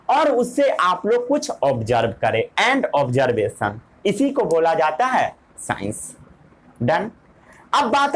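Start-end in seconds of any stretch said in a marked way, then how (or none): 2.7-3.8 each word drawn out slowly for note-taking; slow dictation. Shown as a demonstration. Teacher dictating to students, slow and deliberate. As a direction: spell it out word by word so they can write. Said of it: "पढ़िए एक बार definition.".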